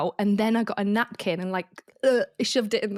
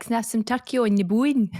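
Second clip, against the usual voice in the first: thick Scottish mum ordering; imitated Scottish accent; 3 semitones higher than usual. Mum orders at the Yorkshire deli, imitated Scottish accent. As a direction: say "bone" with a broad Scottish vowel. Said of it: "obviously my mum's Scottish".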